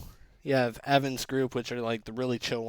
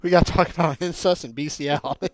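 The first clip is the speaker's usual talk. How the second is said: excited; 4 semitones higher, 9 dB louder than usual. Real, not acted. Excited, laughing announcement.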